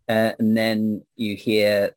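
usual speaking voice

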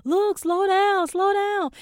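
high, loud, slow call after someone walking away. Retold or imitated. Calling after the girl storming down the pier, high and loud. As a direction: call her to slow down, drawn out. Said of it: "I'm calling after her".

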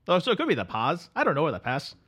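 sleepy Canadian drifter greeting his pal; Canadian accent. Acted. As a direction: play the neighbourly Canadian couch guest. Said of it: "Canada Dave".

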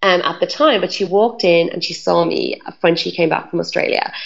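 ordinary talk

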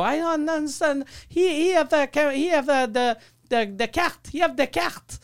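high, halting put-on broken English; playing dumb tourist. To the security guard, pretending not to understand, in broken English. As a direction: act confused and stumble over the words. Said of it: "with your shitty English".

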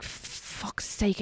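hushed grumble under the breath; acted-out whispered curse; exasperated mutter. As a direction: mutter it softly, privately fed up. Acts out annoyance at splitting bill.

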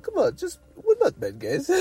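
pleading Santa. Retold or imitated. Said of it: "He's, he's like".

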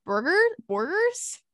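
Burgers? with a questioning rise, hammed up; imitating his accent. Co-host mocking the actor's way of saying burgers, imitating the accent. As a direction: mimic how the actor says Burgers?. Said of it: "the way he says burgers".

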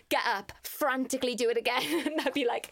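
ordinary talk

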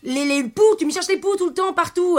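high-pitched exasperated complaint. Acted. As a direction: protest shrilly that you're being picked on. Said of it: "Arrête de crier".

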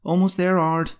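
high, sing-out reply; young apprentice answering a call; calling back. A narrator's reading. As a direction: call back from another room. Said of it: "the young lady called back".

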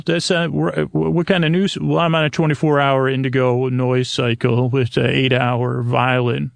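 ordinary talk, nothing marked

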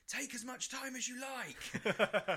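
high, loud drawn-out crowd yell; heckler's shout. Retold or imitated. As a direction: holler it slowly over a whole crowd. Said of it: "someone else shouted".

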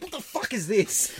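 retold cop in disbelief; incredulous demand. Copper is handed the learner's licence, mock incredulous.

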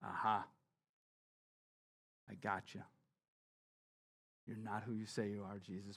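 low, soft, sly gotcha; smug Pharisee quietly catching Jesus out. Retold or imitated. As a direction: purr it like you've caught him out. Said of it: "the Pharisee's like".

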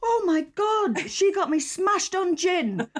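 high, loud, laughing disbelief; shocked gasp. Real, not acted. Host recalling meeting guest at a party, gasping in shock.

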